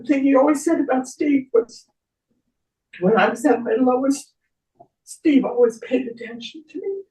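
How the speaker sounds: choked-up pitch rising as tears come; tearful, voice breaking. Real, not acted. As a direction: hold it together while close to crying. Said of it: "I would start to cry".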